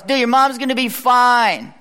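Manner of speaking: imagined breezy shout of reassurance; random dude. Acted. Random dude in a car to crying girl, upbeat call. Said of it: "some random dude in the car goes".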